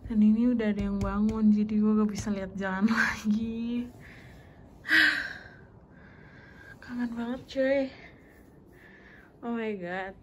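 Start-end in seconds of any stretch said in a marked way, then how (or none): 3.9-6.8 long, loud breathy sigh. Real, not acted. Vlogger worn out, sighing loudly. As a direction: heave a big sigh out.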